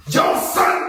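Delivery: loud Brooklyn street call; Brooklyn talk. Acted. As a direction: holler it like a mock Brooklyn local. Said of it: "if you from Brooklyn".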